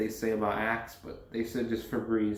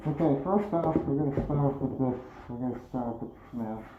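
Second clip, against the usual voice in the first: muffled by gas mask. Real, not acted. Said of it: "with this gas mask on".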